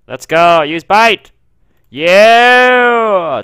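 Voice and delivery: high, loud cheering shout; excited battle cry. Real, not acted. Player cheering on a Pokemon in battle, excited shouting. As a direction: whoop out the attack and cheer it. Battle cries during game fight.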